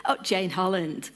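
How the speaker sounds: ordinary talk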